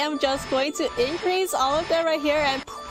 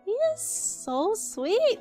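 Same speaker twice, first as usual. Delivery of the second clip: slow high dreamy coo; swooning coo; 9 semitones higher, slower than usual. Real, not acted. Swooning slowly.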